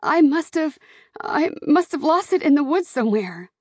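high, flustered daughter; crying out. A narrator's reading. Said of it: "she cried".

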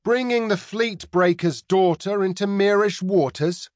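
mock worry, pitched high in the pirate's accented voice; feigned apprehension. A narrator's reading. Pirate teases the captain about Mary, with mock apprehension. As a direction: pretend to be alarmed, plainly faking it. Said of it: "continued with feigned apprehension".

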